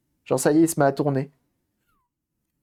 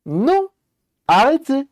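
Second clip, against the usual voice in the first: much higher, louder playful no, stop; mock cry of protest; 10 semitones higher, 6 dB louder, slower than usual. Streamer to chat joking about tasting, mock outraged shout. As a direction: yelp it like a horrified prude.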